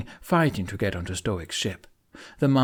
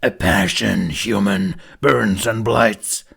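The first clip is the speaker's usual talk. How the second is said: slow, much louder looming monster intonation; ominous demon voice; 8 dB louder, slower than usual. A narrator's reading. Demon threatening the humans, slow booming intonation. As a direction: chant the threat like an ancient beast.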